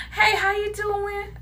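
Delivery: slow, gentle friendly greeting; softened opener. Shown as a demonstration. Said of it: "I soften it".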